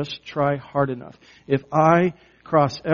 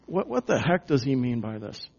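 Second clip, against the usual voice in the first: ordinary talk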